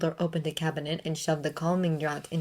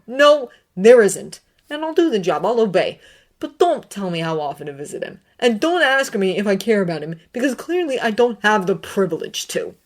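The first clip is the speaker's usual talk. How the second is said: defiant anger; 4 semitones higher, 7 dB louder than usual. A narrator's reading. Severus defying Dumbledore, bitter shouting. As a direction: hammer each refusal.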